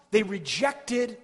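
loud, high, full-force sermon climax; impassioned preaching. Acted. Preacher pleading with the people, full-force preaching. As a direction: pour it out over the congregation.

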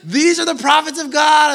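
high, loud frantic cry; possessed girl yelling. Retold or imitated. Possessed girl crying out after Paul, loud and frantic. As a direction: yell it like a girl gone wild. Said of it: "she's going nuts".

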